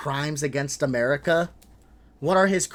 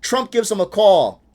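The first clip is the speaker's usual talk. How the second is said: usual speaking voice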